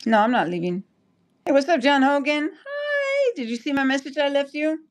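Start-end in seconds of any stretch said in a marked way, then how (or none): 1.4-4.9 high, bright hello to a viewer; excited greeting. Real, not acted. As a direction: greet him with a lifted voice. Greeting viewer John Hogan.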